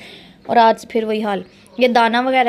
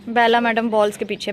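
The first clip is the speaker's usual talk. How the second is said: normal talking voice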